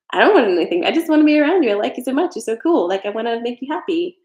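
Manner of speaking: gushing over someone you adore, high and loud; eager giver. Retold or imitated. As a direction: pour it out like an eager friend.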